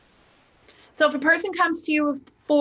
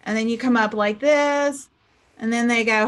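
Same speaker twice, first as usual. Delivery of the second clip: usual speaking voice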